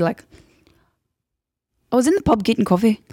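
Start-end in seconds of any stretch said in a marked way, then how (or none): 1.9-3.0 higher, quick Irish lilt; Irish accent with upspeak. Retold or imitated. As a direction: mimic Irish with a little upspeak. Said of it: "always like the little upspeak".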